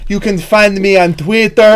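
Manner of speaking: slow, put-on Eastern European accent; drawn-out Eastern European accent; Nico Bellic. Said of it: "I'm fucking Nico Bellic".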